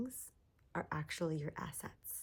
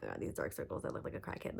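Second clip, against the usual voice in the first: mock self-criticism; 3 dB quieter, faster than usual. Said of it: "I'm too focusing on, like".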